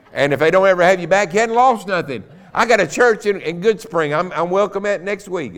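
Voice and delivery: loud, high, jovial preacher's holler; a guest preacher ribbing the church, high and loud; preaching at full force. Acted. Guest preacher teasing the congregation, loud high joking preaching.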